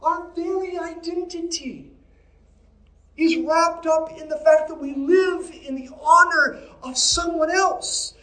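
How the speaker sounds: high, loud preacher's cry; rising sermon climax, slow and strong; preaching at full force. Acted. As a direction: lift the congregation, proclaiming.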